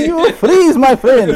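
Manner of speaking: put-on very high, laughing Dutch accent; squeaky hammed-up Dutch local accent. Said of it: "do not question my accent".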